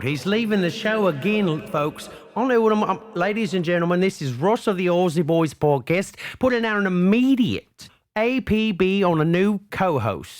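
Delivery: rally the listeners for a new co-host; mock broadcast appeal. Mock announcement, APB for co-host.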